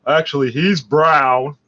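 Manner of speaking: mocking quote. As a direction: drawl it out as if parroting fools. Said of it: "these the same idiots trying to tell me".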